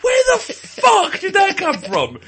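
slow, loud disbelieving yell; mock shout. Imagining a footballer watching a bite, mock shout. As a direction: bellow it in mock outrage. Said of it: "You just think".

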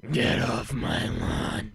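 slow, hoarse whispered growl; Batman-like unvoiced growl; Clint Eastwood. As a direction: rasp it low and drawn out, like a movie tough guy. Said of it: "Gran Torino voice".

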